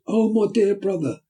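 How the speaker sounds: put-on high, loud cry of a young woman; screaming out loud. A narrator's reading. Nancy seizing Oliver, screaming loud and high. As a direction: scream it out over the street. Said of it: "a young woman screaming out very loud".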